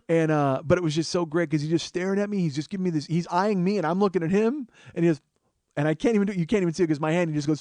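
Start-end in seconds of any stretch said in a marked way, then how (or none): none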